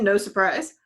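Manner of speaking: ordinary talk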